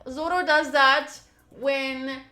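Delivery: usual speaking voice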